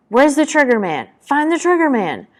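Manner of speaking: high-pitched urgent battlefield shout. A narrator's reading. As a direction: call out orders from farther away. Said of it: "Someone farther away is shouting".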